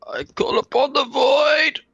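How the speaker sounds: high, loud shout with long final word; shouted incantation. Acted. Player's character shouting at the snake, high theatrical shout. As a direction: bellow it like a spell, stretching the last word. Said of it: "He's gonna shout at the snake".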